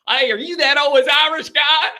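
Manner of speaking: high, loud Southern holler; hammed-up Tennessee accent, calling out. Excited fan greeting the host, imitated Southern accent. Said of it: "they got accents".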